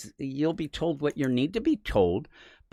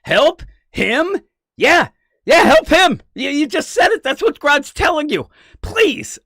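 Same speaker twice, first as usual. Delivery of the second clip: high, loud, exasperated plea building up; frantic pleading; 8 semitones higher, 7 dB louder than usual. Retold or imitated. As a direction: beg him to get it, voice climbing higher and louder. Frantic retold plea to Wally.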